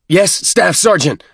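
loud roar, teeth clenched, high; roared through clenched teeth. A narrator's reading. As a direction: force it out through gritted teeth. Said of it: "roared between clenched teeth".

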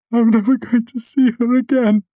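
high, loud, breaking sob; choked out. A narrator's reading. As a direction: burst out through tears. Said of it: "I choked out".

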